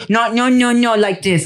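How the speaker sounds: put-on high-pitched child correcting dad; bossy little daughter. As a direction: imitate an insistent kid, voice pitched right up. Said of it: "her very specific way".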